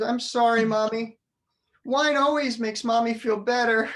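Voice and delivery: put-on sorry little boy. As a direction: sound like a crestfallen kid insisting.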